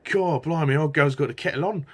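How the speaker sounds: put-on Cockney accent. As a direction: do a broad Cockney geezer. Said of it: "Ray Winston doing the Cockney voice".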